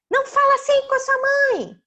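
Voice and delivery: high, loud mock-outraged mum; scolding mother. Mother answering angry child, high loud scolding. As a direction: tell off the child at high pitch.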